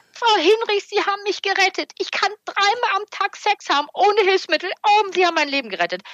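imitated high-pitched, elated cry; shouting down the phone. Overjoyed patient phones the therapist to thank her, mock shouting in a high voice. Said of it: "schreit ins Telefon".

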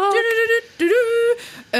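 high two-tone siren noise; playful sung-out siren; imitated warning siren. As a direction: mimic an alarm with your voice.

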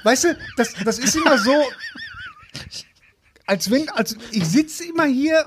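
high, laughing mock lament; mock whiny complaint. Joking to the hosts about being slapped, high mock whine.

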